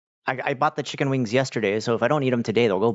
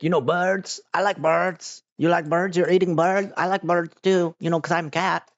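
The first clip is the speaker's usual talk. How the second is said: put-on high, excited cat voice in an odd accent; much higher, goofy foreign-sounding cat; cat with weird accent; 8 semitones higher than usual. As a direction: play a bird-obsessed cat with an indistinguishable accent. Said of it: "he has that weird, indistinguishable accent".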